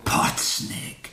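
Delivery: breathless, voiceless whisper of disbelief; stunned whisper. Acted. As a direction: whisper the name as if it can't be true. Shocked at who confessed.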